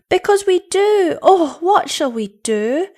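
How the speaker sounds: put-on high, loud childish wail; acted children's outcry, drawing out the end; cried out. A narrator's reading. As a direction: blurt it as upset children. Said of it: "they cried together".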